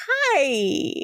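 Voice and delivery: high, drawn-out hi; awkward greeting. Acted. Startled impostor greets soldiers.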